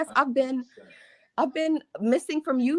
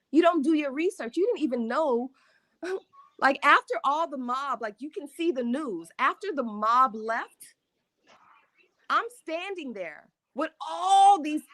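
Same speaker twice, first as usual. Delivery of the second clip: usual speaking voice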